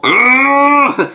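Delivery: loud, high strained "ugh"; hammed-up fighting roar; mock growling yell. Fighter's effort cry in comic.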